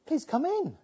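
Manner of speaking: high, pleading father's plea; father begging his son to come inside, pleading. Acted. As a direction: beg him gently to come in. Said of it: "It's almost like he begs him".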